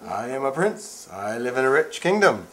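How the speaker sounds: hammed-up prince. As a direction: play a proud young prince. Dad plays prince.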